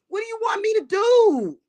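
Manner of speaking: high shouted question. Real, not acted. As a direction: yell it high and loud. Repeated rhetorical question.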